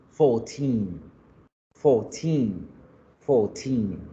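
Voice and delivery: put-on "Fourteen" repeated with a long final -teen; stress on TEEN. Shown as a demonstration. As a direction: hold and stress the last syllable of FOURTEEN. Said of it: "la fuerza se hace en la última sílaba". ("Fourteen" con la última sílaba fuerte y larga)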